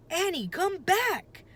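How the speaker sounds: high loud shout after her. A narrator's reading. Jack shouting for Annie to come back, loud. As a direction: yell for her to return. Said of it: "Jack shouted".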